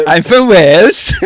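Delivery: loud, high Welsh attempt sliding into Indian; hammed-up, botched Welsh accent; bad Welsh accent. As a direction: overdo a Welsh accent until it sounds Indian. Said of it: "Indian-y accent".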